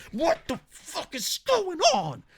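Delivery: very high, slow exasperated cry; hammed-up high-pitched indignation; mock outrage. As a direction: wail it in disbelief. Mock outrage at fake poll.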